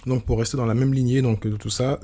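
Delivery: ordinary delivery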